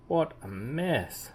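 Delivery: ordinary delivery, nothing marked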